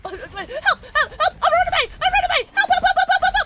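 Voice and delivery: very high, loud laughing cries; playful shrieks; laughing mock panic. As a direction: squeal for help while giggling. Fleeing while laughing.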